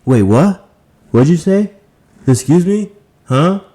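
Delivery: woman who can't hear. Retold or imitated. Woman mocked asking a mumbling man to repeat, high and loud. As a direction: play her straining to hear you. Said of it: "does she have to keep on saying".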